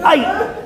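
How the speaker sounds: very high "ay"; mock yelp of pain. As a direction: yelp as if struck on the head. Man hit by a rock.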